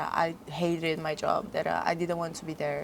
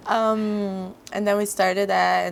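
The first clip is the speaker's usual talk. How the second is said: ordinary talk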